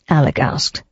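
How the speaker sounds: ordinary talk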